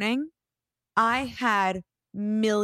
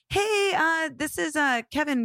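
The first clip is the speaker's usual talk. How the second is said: lightly voiced hesitant caller, pitched higher; higher-pitched, halting phone greeting; imitated stranger caller; 6 semitones higher than usual. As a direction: mimic a casual caller feeling his way. Voices a stranger calling about ad.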